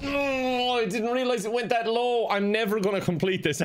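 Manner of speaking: high, alarmed wail; startled dismay. Real, not acted. Startled by a drop in game.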